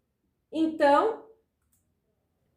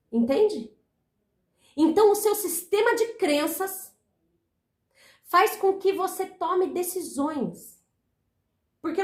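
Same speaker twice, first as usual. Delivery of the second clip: ordinary talk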